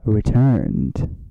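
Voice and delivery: dramatic announcement. Acted. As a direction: announce it like a heroic entrance. Dramatic podcast return, Avengers pun.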